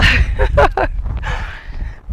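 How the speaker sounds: breathy laugh trailing off; hearty laugh. Real, not acted. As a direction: laugh out mid-workout. Laughs while cheering the climb.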